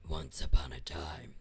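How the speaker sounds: very low whispered demon; demon storyteller. Pretend. Streamer opens a story in a demon voice, whispered. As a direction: breathe the words like a demon. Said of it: "read us ... in a demon voice".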